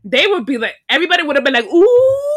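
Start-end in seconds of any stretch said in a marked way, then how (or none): 1.7-2.4 high, loud schoolyard ooh; a much higher, loud teasing ooh; mocking crowd ooh. Kids reacting to a bold comeback, loud high ooh.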